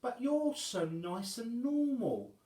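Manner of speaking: high, loud and very slow lament; self-pitying giant. Acted. Wobbling telling the boy she is hideous, high and slow. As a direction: drag out her sorrow.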